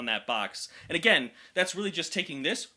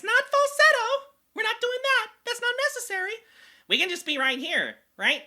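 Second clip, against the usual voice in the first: speaking much higher in a goblin voice; 5 dB louder than usual. Shown as a demonstration. Coach explaining in goblin voice, no falsetto needed. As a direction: talk in a high goblin squeak. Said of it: "ready for that goblin voice".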